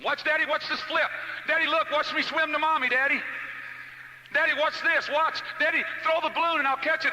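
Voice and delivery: put-on high, loud child begging for attention; squealing-high kid calling out to Daddy; excited kids. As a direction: shout it like kids in a pool. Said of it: "you can't make them not swim".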